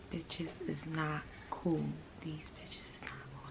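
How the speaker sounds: hushed, voiceless swearing under the breath; whispered cursing. Real, not acted. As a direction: whisper the curse words, fading to almost nothing. Muttering curses on camera.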